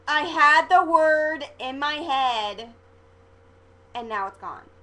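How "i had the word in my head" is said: much louder, slow exasperated cry; frustrated outburst. Real, not acted. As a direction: blurt it out in frustration. Searching for a lost word.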